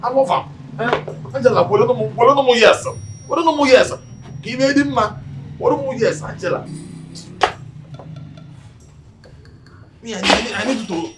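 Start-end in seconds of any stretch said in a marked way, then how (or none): 1.4-5.9 high, louder, repeated challenge; worked-up exclaiming. Acted. Man exclaiming over Angela, worked up. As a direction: throw it out high and insistent.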